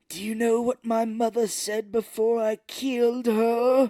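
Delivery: high, slow hammed-up villain; campy high villain taunt; mock villain voice. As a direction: ham up the creepy villain reveal. Retelling villain line, mock voice.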